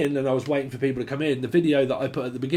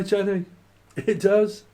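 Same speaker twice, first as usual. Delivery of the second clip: normal talking voice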